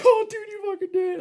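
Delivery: very high, shocked disbelief; amazed coworker. Retold or imitated. The coworker reacting to the spit, in a very high amazed squeal. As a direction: squeal it like you can't believe he did it.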